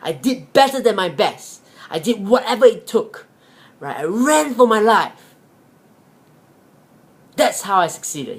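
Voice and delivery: proud, passionate telling of the escape; injured rabbit telling his family how he outran the dogs; emphatic rabbit. Acted. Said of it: "the rabbit said".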